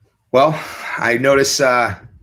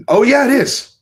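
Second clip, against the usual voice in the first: much higher and louder, delighted; excited discovery; 12 semitones higher, 7 dB louder than usual. Real, not acted. Finds the hot sauce on Amazon, delighted. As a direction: pop up with delight. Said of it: "finds it on Amazon".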